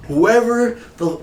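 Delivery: imitated angry coach, pitched high; pissed off. Golf coach confronting class over a prank, pissed off. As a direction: start a retold angry demand, drawn out. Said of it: "he was pissed".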